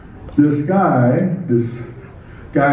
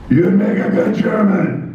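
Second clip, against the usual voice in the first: higher-pitched quote of another wrestler; imitated fellow wrestler; 5 semitones higher than usual. Champion wrestler sizing up a new guy, imitated high voice. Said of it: "but he said".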